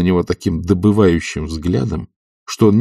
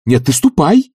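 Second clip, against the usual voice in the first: raised, louder urging; insistent; 8 semitones higher, 5 dB louder, slower than usual. A narrator's reading. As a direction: push the order with rising pitch. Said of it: "настойчиво говорил Обломов".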